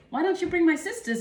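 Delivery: put-on high, eager kid's voice asking; lightly voiced young girl begging; childlike plea. Kid asking dad to bring her sisters, high and eager.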